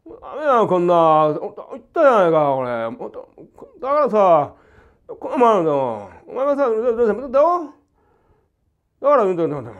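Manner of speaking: loud, high scolding rant; a teacher acting out a quarrel to show the rhythm of Japanese; mock quarrel. Shown as a demonstration. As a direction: tell someone off in a demo argument. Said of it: "口論がありまして".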